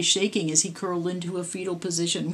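ordinary delivery

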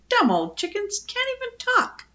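high-pitched scornful parrot; indignant, high squawk. A narrator's reading. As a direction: pour high-voiced contempt on them.

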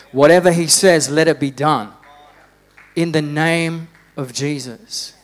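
preaching at full force. Real, not acted.

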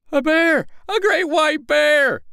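high, loud and slow calling out; alarmed calling. Acted. Tailor and tinker calling to the town as they run, alarmed. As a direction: raise the alarm while running. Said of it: "they called as they ran".

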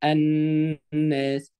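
الناس with a long, hummed double ن; ghunna on النون. Shown as a demonstration. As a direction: stretch the nasal nn of الناس very slowly. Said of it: "بنعمل فيها غنة".

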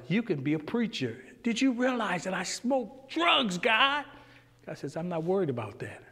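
ordinary talk, nothing marked

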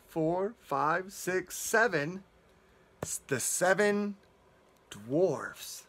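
ordinary delivery, nothing marked

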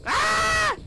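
high panicked yell. Real, not acted. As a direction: scream that he's about to die. Fleeing an enemy in game.

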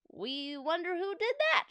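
high, wondering chorus; crowd's puzzled question, pitched high; fans calling out. A narrator's reading. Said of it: "shouted the fans in the bleachers".

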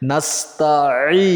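নাস্তাঈ slow, with the আইন pressed from the middle of the throat; slow careful নাস্তাঈ, rising at the end; correct throat sound. Shown as a demonstration. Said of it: "এই যে ছাপ দিবেন".

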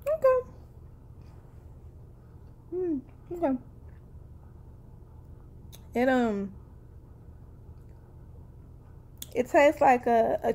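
chewing while talking; muffled by food in mouth; mouth full. Real, not acted. Seen 0: crisp to mouth at -9, then lips closed, chewing with hand at chin.

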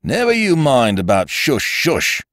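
sharp, sarcastic constable retort; a policeman mocking the whisper, tartly; with asperity. A narrator's reading. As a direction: throw the shush back mockingly. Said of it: "said the constable with asperity".

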